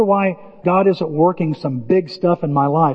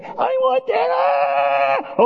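put-on whining little kid; much slower than usual. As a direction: whine like a kid wanting a toy. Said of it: "whine long enough like a little kid".

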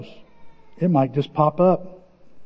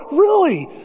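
astonished; 10 semitones higher than usual. Retold or imitated. Pastor's astonished reply.

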